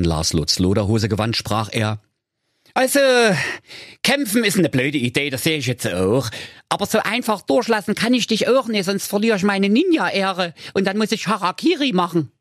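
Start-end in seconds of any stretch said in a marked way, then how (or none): 2.7-12.3 high, anxious cartoon ninja; comic ninja voice. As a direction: mutter worried thoughts, high pitched. Ninja speaks.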